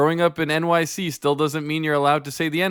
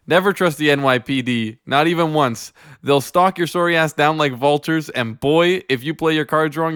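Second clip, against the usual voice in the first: normal talking voice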